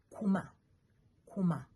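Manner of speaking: kuma with a short, quick u; kuma, no lengthened vowel. Shown as a demonstration. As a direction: keep the u in kuma brief. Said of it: "are not long".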